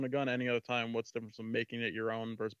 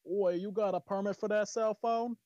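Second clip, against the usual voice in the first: high, slow mock-British challenge; British accent; 10 semitones higher, slower than usual. Briton demanding a cell phone permit, mock British accent. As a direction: put on a high-pitched, nosy British accent. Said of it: "Say it in a British accent".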